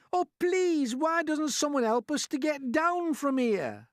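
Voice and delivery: very high, loud, drawn-out plea; calling out. A narrator's reading. Miss Spider begging the men for help, calling out. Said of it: "Miss Spider called out".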